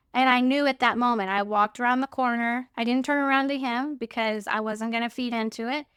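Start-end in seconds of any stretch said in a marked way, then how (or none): none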